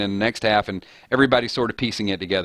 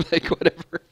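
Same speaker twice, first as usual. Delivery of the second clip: higher, amused and laughing; giggly, pitched-up dismissal; 8 semitones higher than usual. Real, not acted. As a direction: say it through a laugh. Amused after the assistant's recital.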